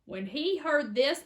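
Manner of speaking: ordinary delivery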